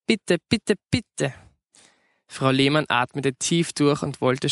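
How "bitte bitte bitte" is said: put-on high-pitched childlike begging; wheedling little plea; pleading. A narrator's reading. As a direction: beg Mum, each word set apart. Child begs mother for new CD.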